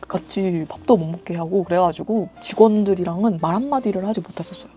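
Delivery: ordinary delivery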